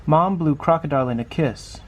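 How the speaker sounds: usual speaking voice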